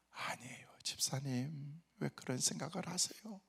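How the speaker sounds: hushed, gentle denial; whispered reassurance. Retold or imitated. Pastor gently tells the apologizing deacon not to worry, whispered.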